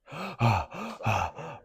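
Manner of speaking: a sharp demo gasp, like after a sprint; gasping for air. Shown as a demonstration. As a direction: show them what sucking air sounds like. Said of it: "I'm sucking air".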